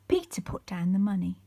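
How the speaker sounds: ordinary delivery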